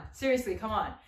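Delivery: ordinary talk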